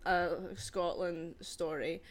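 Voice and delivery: put-on Scottish accent. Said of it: "with my Scottish accent?".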